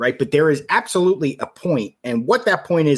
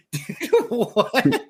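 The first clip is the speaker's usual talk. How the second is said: laughing disbelief; 3 semitones higher than usual. Retold or imitated. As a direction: laugh through the outrage. Retelling indignant reply while laughing.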